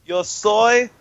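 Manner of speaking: hammed-up fake Italian, slow and drawn out; a joking fake Italian accent; mock Italian accent. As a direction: ham it up like a cartoon Italian. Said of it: "In an Italian accent".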